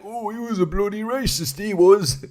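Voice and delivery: high-pitched caricature of a disapproving Brit; mock TV commentator. Channel briefly dismissing dead [name], mock-disapproving high voice. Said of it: "just be like".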